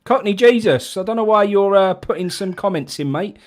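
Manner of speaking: high, loud call to a viewer. Real, not acted. Host loudly calling a commenter to join in. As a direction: shout him onto the show.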